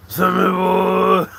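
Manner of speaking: high, slow stretched-out call of the name; drawn-out call. Acted. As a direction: sing the name out long and loud.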